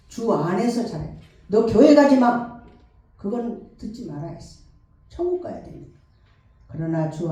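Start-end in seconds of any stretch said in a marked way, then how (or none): 1.5-2.6 stern forbidding. Retold or imitated. Quoted parent forbidding church.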